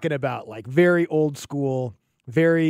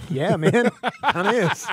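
amused, higher-pitched concession; laughing admission; 5 semitones higher than usual. Retold or imitated. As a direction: chuckle through the words. Host laughs admitting radio is dying.

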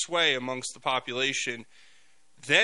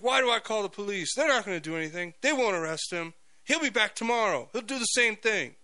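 higher, lightly voiced shop owner, no accent attempted; a hint of an exasperated store owner, pitched up; quoting the gas station owner; 7 semitones higher than usual. Gas station owner brushing off calling the police, in a higher mock voice. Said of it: "I can't do an Indian accent".

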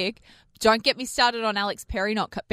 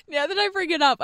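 laughing; 7 semitones higher than usual. Real, not acted. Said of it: "But now that I bring it up".

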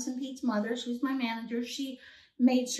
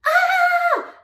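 very high loud shriek of joy; joyful scream; 11 dB louder than usual. Retold or imitated. A high loud shriek after hanging up the phone. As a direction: scream with delight. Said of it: "And I went".

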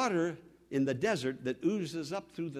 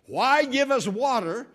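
loud, raised, drawn-out complaint; disappointed protest; 7 semitones higher, 9 dB louder than usual. Acted. Let-down people questioning God, loud disappointed protest. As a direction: cry out against the letdown. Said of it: "a letdown and a disappointment".

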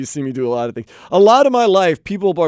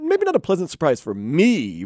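ordinary talk, nothing marked

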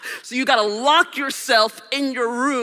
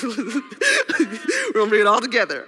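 laughing. Real, not acted.

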